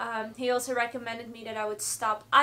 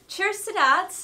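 upbeat, raised cheers; cheerful toast; 8 semitones higher, 6 dB louder than usual. Real, not acted. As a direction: toast it brightly. Toasting her supporters.

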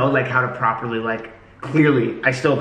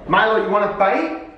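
mock outrage; 8 semitones higher, slower than usual. Mock outrage at Starbucks jab.

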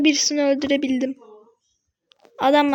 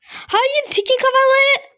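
panicked cry; 10 semitones higher than usual. Real, not acted. Gamer panics at enemy Tik.

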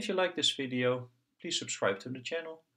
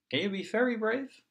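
encouraging; 12 semitones higher, 4 dB louder than usual. Acted. Doctor encourages before the shot.

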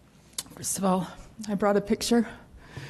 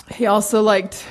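ordinary delivery

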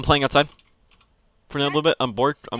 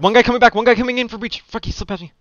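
alarmed callout; 8 semitones higher than usual. Real, not acted. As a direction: warn the team urgently. Warning teammates of an enemy.

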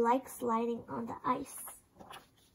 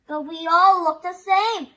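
higher, louder voiced complaint; frowning protest; 6 semitones higher, 11 dB louder than usual. A narrator's reading. Young penguin objecting to her uncle, loud frowning protest. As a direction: object as a put-out little penguin. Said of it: "Penguin frowned".

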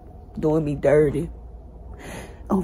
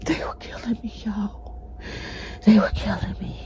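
softer, whispered through tears; exhausted whisper; 3 dB quieter than usual. Real, not acted. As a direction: whisper it, spent from crying. Recounting torture, exhausted.

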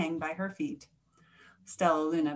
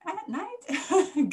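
halting gasp, leaping high on the last words; breathless, high-rising shock; astonished gasp; 9 semitones higher, much slower than usual. A narrator's reading. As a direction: gasp it in disbelief, broken in two. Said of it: "gasped another".

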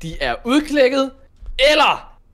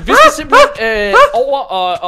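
laughing; 5 semitones higher, 9 dB louder than usual. Real, not acted. Excited gamer bursts out laughing.

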